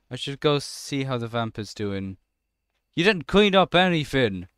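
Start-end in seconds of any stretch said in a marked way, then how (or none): 2.9-4.5 higher, louder indignant scolding; exasperated telling-off. Pretend. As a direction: tell off a useless helper with mock outrage. Scolding the vampire for not cleaning.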